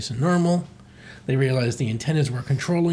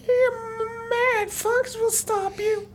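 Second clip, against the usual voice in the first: very high cartoon falsetto; Slippy; much slower than usual. Captive Slippy defies the mad villain, squeaky falsetto. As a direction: squeal it out, drawing out the words.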